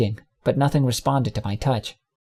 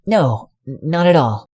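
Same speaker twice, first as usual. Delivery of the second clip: exclaiming; 4 semitones higher than usual. Acted. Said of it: "I exclaimed".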